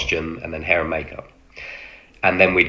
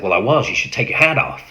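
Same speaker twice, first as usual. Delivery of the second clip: rising into a higher, half-voiced American order; American accent; 5 semitones higher than usual. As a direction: stay in the American officer voice. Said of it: "he stayed in his American voice".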